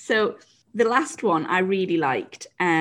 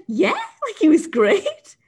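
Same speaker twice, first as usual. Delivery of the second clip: higher, brightly proud reply; chirpy; 11 semitones higher, 5 dB louder, slower than usual. Retold or imitated. Rider answering the coach, higher, proud reply. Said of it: "And I said".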